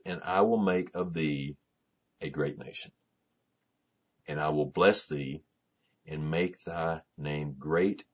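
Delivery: slow, measured Bible reading with pauses; weighty recitation of a divine promise; solemn scripture reading. Acted. Reads Scripture aloud.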